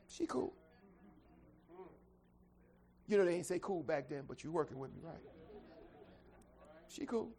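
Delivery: loud, high whooping sermon climb; preaching at full force. Real, not acted. Preacher whooping to the congregation, loud and high. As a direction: build the sermon to a roaring peak.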